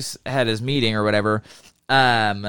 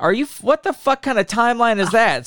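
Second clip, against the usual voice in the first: higher, incredulous angry outburst; exasperated outburst; 9 semitones higher than usual. Retold or imitated. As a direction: blurt it out in disbelief at the laptop. Retold outburst at laptop update.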